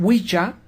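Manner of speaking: "Wicca" pronounced WEE-cha; old English pronunciation. Shown as a demonstration. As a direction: soften the cc of "Wicca" into a ch. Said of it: "se pronunciaba como".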